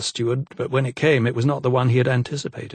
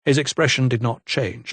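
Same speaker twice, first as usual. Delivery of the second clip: usual speaking voice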